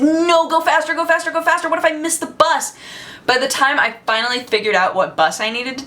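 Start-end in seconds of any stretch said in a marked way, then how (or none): 0.0-2.7 frantic urging. Retold or imitated. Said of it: "I was like".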